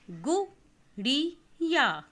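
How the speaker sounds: गुड़िया stretched out slowly and loud, syllable by syllable; slow syllabic reading. Shown as a demonstration. Teacher models गुड़िया slowly and loudly for the class. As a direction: draw out गुड़िया slowly for children to copy. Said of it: "देखिए कि मैं किस तरीके से पढ़ रही हूँ".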